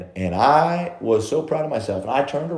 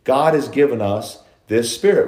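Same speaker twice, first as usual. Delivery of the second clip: usual speaking voice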